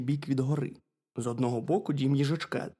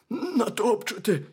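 high, raised hare voice; fearful hare; 6 semitones higher, 4 dB louder than usual. A narrator's reading. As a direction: plead it as a small worried hare. Hare fears being eaten.

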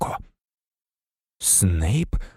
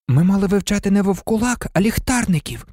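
high-pitched pupil correcting the teacher; high, urgent complaint; blurted protest; 8 semitones higher than usual. A narrator's reading. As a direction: object in a high, insistent girl's voice. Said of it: "не стрималася Герміона".

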